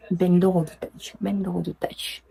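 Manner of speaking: "Ben Loro Dutch" chuchoté sans voix; whispered. Shown as a demonstration. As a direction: souffler "Ben Loro Dutch" pour s'entraîner. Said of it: "J'espère que je prononce bien". ("Ben Loro Dutch" chuchoté)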